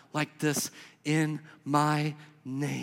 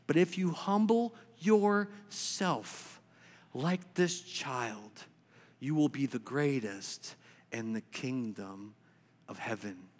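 tender reading; 5 semitones lower, 8 dB quieter, slower than usual. Acted. Quoting Jesus softly, slowly.

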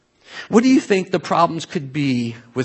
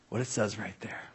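hushed whisper; 6 semitones lower, 16 dB quieter than usual. Retold or imitated. Old pastor marvelling at scripture.

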